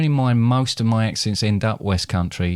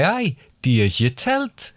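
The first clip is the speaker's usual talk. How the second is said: slow broad Geordie accent; 4 semitones higher than usual. Shown as a demonstration. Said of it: "get into the character".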